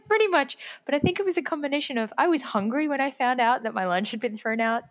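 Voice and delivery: ordinary talk, nothing marked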